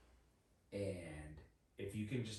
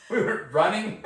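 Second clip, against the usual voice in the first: laughing through the words; cracking up while telling; 21 dB louder than usual. Real, not acted.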